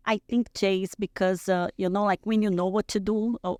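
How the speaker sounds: ordinary talk, nothing marked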